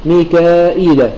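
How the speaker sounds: slow "ميكائيل" with both hamza and ya sounded; ميكائيل بإثبات الهمزة والياء; reading with hamza and ya. Shown as a demonstration. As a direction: draw out "ميكائيل", pronounce mi-ka-'i-il fully. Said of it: "يقرؤون بإثبات الهمزة والياء".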